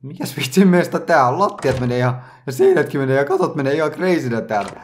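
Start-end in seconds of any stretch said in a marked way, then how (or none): none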